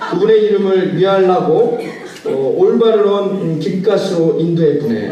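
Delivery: lightly voiced Jeolla country accent, chuckling through it; Jeolla dialect psalm. Shown as a demonstration. Pastor to congregation, playful Jeolla dialect parody. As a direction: read the psalm as a folksy Jeolla local, laughing along. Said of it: "제가 사투리를 잘 못하는데 전라도 버전이 있습니다".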